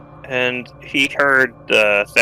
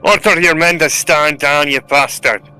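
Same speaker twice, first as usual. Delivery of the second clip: raised, louder commanding voice; in-character shout; 4 semitones higher, 5 dB louder than usual. Acted. Soldier ordering an enemy to stand down, shouting. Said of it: "of course he's shouting German".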